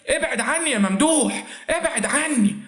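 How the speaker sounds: high-pitched narrated cry to push him away; hammed-up protest, pitch up; mock scream. A narrator's reading. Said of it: "وصرخت تاني شربات". (صرخة مصطنعة بصوت عالي النبرة)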